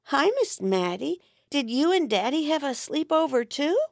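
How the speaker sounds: put-on a small boy asking, puzzled and innocent; little boy. Little boy asks his father's girlfriend.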